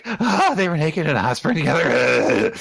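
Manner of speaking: high gasp sliding down; mock-swooning high girl voice; flustered girl. Acting flustered Mimosa.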